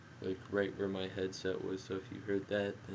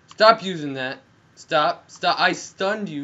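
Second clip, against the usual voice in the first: loud, higher exasperated yells; annoyed shouting; 8 semitones higher, 15 dB louder, slower than usual. Real, not acted. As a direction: bark at the enemy to quit it. Angry at enemy.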